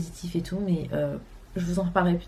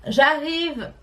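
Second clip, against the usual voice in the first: calling out; 8 semitones higher than usual. Real, not acted. Calling out to someone off camera.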